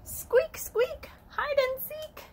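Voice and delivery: very high squeaky voice. Acted. Teacher reading hide and seek rhyme, high squeaking. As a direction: squeak it out like a tiny animal.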